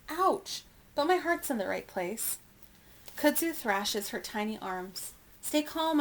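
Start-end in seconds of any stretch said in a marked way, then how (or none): 0.1-0.6 high-pitched little yelp of pain. A narrator's reading. Elephant yelps as her tail is grabbed, high yelp. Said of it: "she yelps". 5.4-6.0 higher-pitched call to steady someone. A narrator's reading. Calling to a thrashing baby gorilla, raised call. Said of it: "I call".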